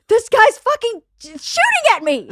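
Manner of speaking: acted-out frantic alarm; retold panic. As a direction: cry out as if fleeing gunfire. Retold panic while fleeing a gunman.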